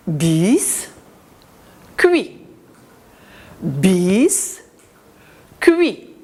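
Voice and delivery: syllable by syllable, bis pause cuit; split into syllables. Shown as a demonstration. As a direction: say biscuit in two beats, Bis... cuit. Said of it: "On va le découper en syllabes".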